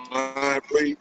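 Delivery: normal talking voice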